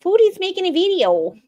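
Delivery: high, bright mom voice; high-pitched doting mom; imitating her mom. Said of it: "I like when her mom".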